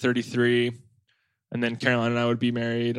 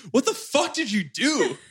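higher, slow shocked disbelief; shocked friend; 10 semitones higher than usual. Retold or imitated. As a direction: drag it out, stunned at the news. Friend's shocked call retold.

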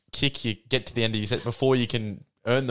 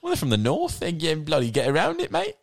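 higher-pitched, badly faked Cockney; bad Cockney accent; 6 semitones higher than usual. Podcaster imitating English football blokes from the north, bad Cockney accent. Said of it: "That is a Cockney accent".

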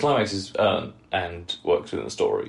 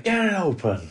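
much higher and louder, a playful Angry Jack shout; mock angry yell; 11 semitones higher, 4 dB louder than usual. Podcaster imitating Angry Jack at the shutter, hammed-up yell. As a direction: bark the order like Jack at the shutter.

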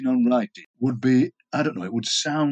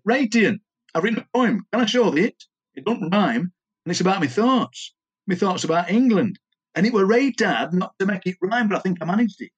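higher-pitched, dialect-speaking amateur poet; Norman; 5 semitones higher than usual. Acted. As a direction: recite it as an eager visitor sharing his poem. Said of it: "Norman came to my house and said".

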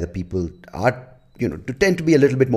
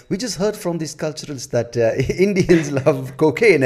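higher, lightly mocked French accent; attempted French accent; 8 semitones higher than usual. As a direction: try on a French accent, amused. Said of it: "for that attempted French accent".

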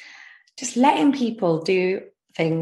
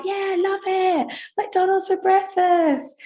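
mock gushing, much higher and slow; fake enthusiasm; 13 semitones higher, slower than usual. Faking delight to TV producers, high slow mock gush. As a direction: oversell the delight through clenched politeness.

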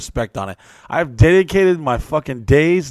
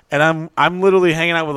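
normal talking voice

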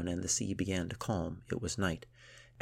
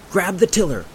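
high, forceful yelling; shouted orders; 13 semitones higher, 14 dB louder than usual. A narrator's reading. Gunhild to her frozen friend at the tiller, shouting. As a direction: yell commands at someone frozen in panic. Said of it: "shouted Gunhild again".

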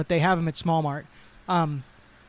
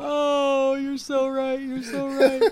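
mock agreement; 7 semitones higher, slower than usual. Mock agreement, high put-on voice.

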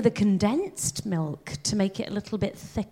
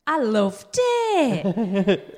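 put-on slow, drawn-out Northern English accent through a laugh; much slower than usual. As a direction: stretch it out in a Northern accent, giggling. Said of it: "Do they have to do it in the Northern accent?".

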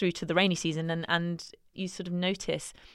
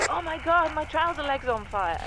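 higher and fast, imitating a startled colleague; alarmed cry; 10 semitones higher than usual. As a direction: mimic his panic. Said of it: "He says".

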